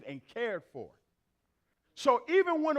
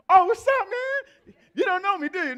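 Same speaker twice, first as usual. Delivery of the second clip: surprised stranger; 9 semitones higher, 9 dB louder than usual. Retold or imitated. Brother's surprised reply acted.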